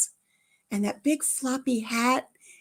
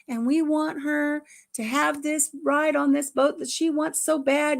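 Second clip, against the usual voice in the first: Southern Baptist revival preacher; 7 semitones higher, 5 dB louder than usual. Retold or imitated. Woman prays over a little girl's nickel, fervent revival style. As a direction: cry it out to the Lord like a revival preacher. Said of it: "like I was at a Southern Baptist re-, revival".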